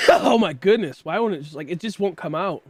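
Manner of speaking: ordinary delivery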